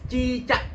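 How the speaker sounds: "cicak" high, with an open "cak"; cicak terbuka. Shown as a demonstration. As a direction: open the mouth on the end of "cicak". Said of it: "caknya itu dibuka mulutnya". ("cicak", bagian "cak" dibuka)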